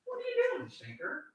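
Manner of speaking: very high, hushed voice for a cat; tiny squeaky cat-talk voice; pet talk. Real, not acted. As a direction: coo it softly, way up high. Cooing to the cat.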